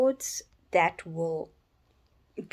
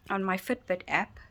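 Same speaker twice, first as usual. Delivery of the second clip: ordinary delivery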